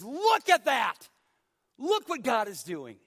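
loud, high, ringing pulpit proclamation; fiery revival preacher crying out; full-force preaching. Acted. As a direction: preach it out to the back row.

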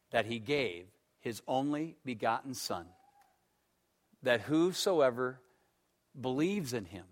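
hushed and measured, like reading scripture at a bedside; reverent. Retold or imitated. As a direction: recite the verse softly, as something sacred. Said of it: "And very reverently I said".

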